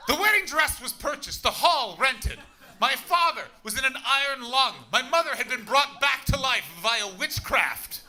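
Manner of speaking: high, loud overblown storytelling; hammed-up breathless tale, high and loud; excited mock drama. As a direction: pump it up like a wild gothic saga. Comic mock epic tale, excited.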